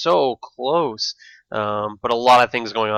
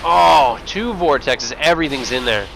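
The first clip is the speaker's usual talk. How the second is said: louder, rising hype as fighting breaks; excited play-by-play; 2 semitones higher, 3 dB louder than usual. Real, not acted. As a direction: call the fight with growing excitement. Caster calling the big fight.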